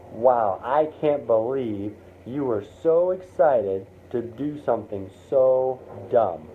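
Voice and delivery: slow, deliberate put-down; class bully. Acted. Class bully points a finger at you, scornful. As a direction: draw out each scornful phrase. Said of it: "the class bully stands up".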